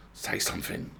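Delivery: whispered hiss. A narrator's reading. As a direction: hiss it urgently under breath. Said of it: "hissed Nasilka".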